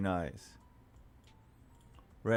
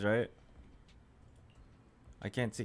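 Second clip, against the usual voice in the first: normal talking voice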